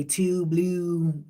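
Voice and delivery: TWO BLUE with drawn-out Baltimore U sounds; Baltimore accent. Shown as a demonstration. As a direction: lightly imitate Baltimore, dragging the vowels in "two blue". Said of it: "they drag the U's in Baltimore".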